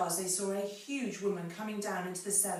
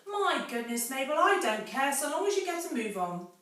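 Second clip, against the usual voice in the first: louder, higher, exasperated; impatient mistress; 7 semitones higher, 7 dB louder than usual. Acted. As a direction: hurry the servant along. Impatient Mrs Bean.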